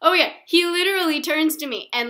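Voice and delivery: usual speaking voice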